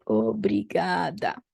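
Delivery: "Obrigada." slow, ending in a; slow feminine form. Shown as a demonstration. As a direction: draw out "Obrigada." with final a. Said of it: "if it's a lady who is thanking".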